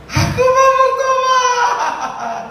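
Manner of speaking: very high, slow, tearful sung scream. Acted. Risen husband screaming his wish to repent, high tearful wail. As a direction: wail it like a man terrified of hell. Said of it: "Dia menjerit".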